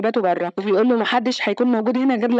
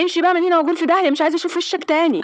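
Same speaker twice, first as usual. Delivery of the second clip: furious get out; 7 semitones higher, faster than usual. Retold or imitated. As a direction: drive him out the door in a fast fury. Father throws the man out.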